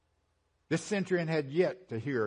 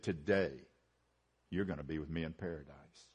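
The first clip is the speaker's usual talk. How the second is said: soft, much lower and quieter promise; tender; 9 semitones lower, 8 dB quieter, faster than usual. Retold or imitated. Jesus to the repentant thief: soft tender promise. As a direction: say it gently, like comfort to a dying man. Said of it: "he said it in a tender voice".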